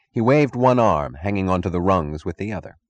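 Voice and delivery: ordinary delivery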